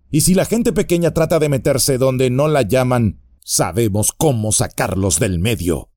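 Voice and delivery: bar their way with a sneer; burly bandit voice, taunting; menacing bandit. Acted. Bandit threatens Frodo.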